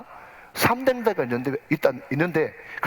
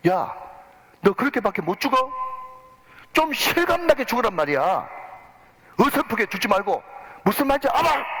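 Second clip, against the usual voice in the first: retold director's yell; 7 semitones higher than usual. Director yelling at the actor who died badly, angry shout. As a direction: bark at the clumsy actor like a furious director. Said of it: "막 고함을 지르는데".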